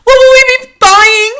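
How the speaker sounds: high, loud cackling witch chorus; shrill eager witches calling up to their leader; witches crying out. A narrator's reading. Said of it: "they cried".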